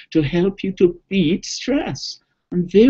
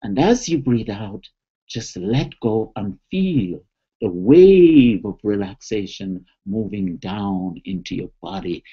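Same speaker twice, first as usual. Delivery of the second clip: guided relaxation; 7 semitones lower than usual. Shown as a demonstration. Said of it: "so here we go".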